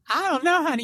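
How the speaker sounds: high mock-Southern echo; teasing imitation of a Southern twang; imitated Southern accent. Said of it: "what Southern accent you're talking about, honey".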